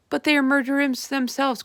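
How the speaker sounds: loud, high protest; indignant cry. A narrator's reading. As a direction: fire back in outrage. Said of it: "cried the head of the army".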